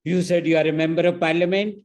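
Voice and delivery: woman on the flight scorning the MP, loud and incredulous; angry scornful question. Retold or imitated. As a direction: sneer at the title he claimed. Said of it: "laughing angrily".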